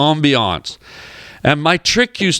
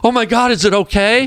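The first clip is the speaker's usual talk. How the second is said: higher-pitched mock concern; alarmed question; 7 semitones higher than usual. Producer asking after the dog, mock alarm. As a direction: imitate her worried follow-up. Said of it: "she did an immediate follow-up question".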